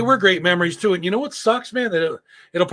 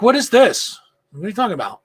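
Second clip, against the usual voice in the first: imitating an indignant wife, higher at first; mock indignant; 5 semitones higher than usual. Wife finding the wrong rolls, mock indignant. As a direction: start high, then drop back.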